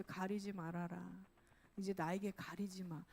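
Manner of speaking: low, soft divine voice; lightly voiced God, hushed and low; God's gentle plea. God telling churchgoers not to hide, gentle plea. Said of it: "하나님께서 교회에 와서".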